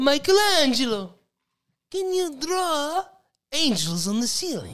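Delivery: priest asks in a fake Russian voice; hammed-up Russian-sounding accent; mock Russian accent. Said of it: "That's still Russian".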